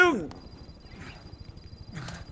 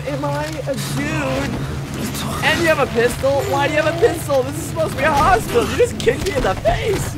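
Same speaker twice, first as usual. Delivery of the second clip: excited protest; 7 semitones higher than usual. Real, not acted. Confused protest at game events.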